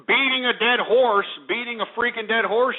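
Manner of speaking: usual speaking voice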